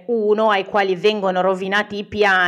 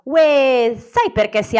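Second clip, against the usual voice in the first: playful joke setup; 5 semitones higher, 5 dB louder, slower than usual. Retold or imitated. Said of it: "la scuoto dicendo".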